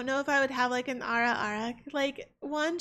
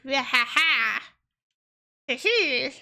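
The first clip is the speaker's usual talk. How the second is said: playful mock vampire laugh; put-on "ha ha, hee hee" laugh; vampire cackle; 4 dB louder, slower than usual. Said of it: "become a vampire just so I could just be like".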